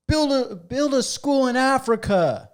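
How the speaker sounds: slow, high, drawn-out heckler yell; mock heckler shout from the audience; yelled heckle. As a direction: call it out from the crowd, stretched. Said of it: "some guy or kid just yells out".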